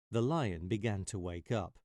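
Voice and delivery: ordinary delivery, nothing marked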